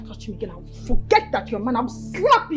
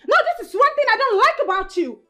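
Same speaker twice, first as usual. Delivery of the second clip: angry outburst; 7 semitones higher than usual. Acted. Girlfriend shouts at doubting boyfriend.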